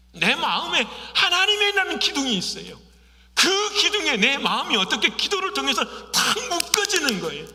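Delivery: loud, driving sermon voice; fervent preaching. Acted. Pastor urging the congregation on prayer, fervent preaching.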